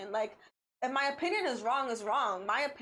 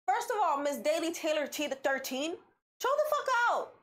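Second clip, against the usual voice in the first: high-pitched indignant telling-off; exasperated outburst; 4 semitones higher than usual. Real, not acted. Host scolds the fan in the tweet, exasperated. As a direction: scold sharply, voice jumping up high.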